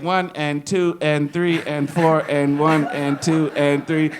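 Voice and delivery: slow, loud counted beat; slow counting. Retold or imitated. Drummer mimics teacher counting the beat, slow and loud. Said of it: "she gonna be like".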